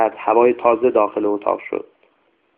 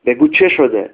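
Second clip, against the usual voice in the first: higher, snapping out the demand; sharp demand; 4 semitones higher, 3 dB louder than usual. A narrator's reading. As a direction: press her impatiently for the answer. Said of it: "فریدون تشخ زد".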